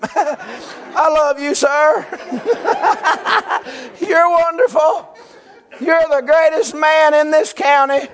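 high, laughing, lovestruck gushing; giddy wife. Retold or imitated. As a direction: imitate a drugged-happy wife swooning over the doctor. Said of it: "she was saying crazy stuff like that".

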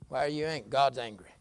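ordinary delivery

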